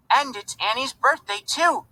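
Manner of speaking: put-on high, childlike piping voice; piped up little boy. A narrator's reading. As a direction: pipe it up like a small boy. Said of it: "little Philip piped up".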